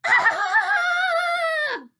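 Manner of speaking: very loud, extremely high long shriek; full-blast audition scream; all-out scream. A narrator's reading. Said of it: "And again".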